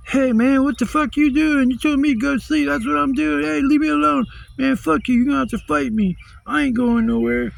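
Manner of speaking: high, loud, whiny imitation; hammed-up indignant squawking at the cops; mock drunk protest. Imitates drunk neighbor resisting cops.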